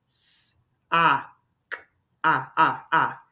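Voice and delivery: "S, ah, k" as three isolated sounds, then "ah" repeated; segmented phonics demo of "S, ah, k"; tapping out sounds. Shown as a demonstration. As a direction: hiss "S", give a short "ah", click "k", then repeat the "ah". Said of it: "If I tap it out".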